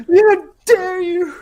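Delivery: high, slow, hammed-up cry of outrage; mock outrage. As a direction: rage at a tree, played for laughs. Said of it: "Kicking it".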